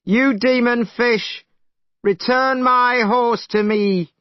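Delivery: high, slow, loud call. Acted. Monkey calls into the river to the dragon, loud challenge. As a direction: bellow it across the water. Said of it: "he called into the water".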